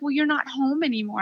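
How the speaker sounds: put-on soft, high little-kid voice; four-year-old son. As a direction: quietly mimic a small boy's innocent complaint. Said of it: "he's like".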